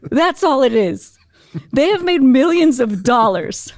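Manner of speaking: high, slow, punched incredulity; comic emphatic rant. Retold or imitated. Speaker cheering up young lady, comically incredulous.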